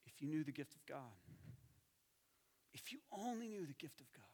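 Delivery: gentle pleading sorrow; compassionate tone. Shown as a demonstration. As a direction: say it with heartfelt longing. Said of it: "This is how Jesus talked".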